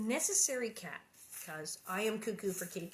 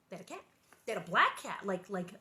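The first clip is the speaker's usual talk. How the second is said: usual speaking voice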